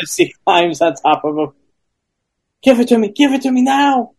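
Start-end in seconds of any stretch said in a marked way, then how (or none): 2.6-4.1 breathless, high and pleading; eager woman voice. Acted. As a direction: beg for it, high-pitched. She climbs on top of him.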